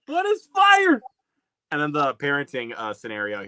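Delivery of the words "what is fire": high squeaky dopey voice; goofy high parody; mimicking the clip. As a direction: pitch way up and play dumb. Repeats the gag.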